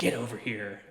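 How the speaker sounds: low, soft bad ninja villain impression; lightly voiced game villain callout; Scorpion voice. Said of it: "That was not a good Scorpion impression".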